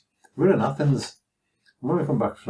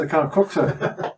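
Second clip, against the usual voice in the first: laughing reply; 7 semitones higher, slower than usual. Retold or imitated. Retold reply to officer.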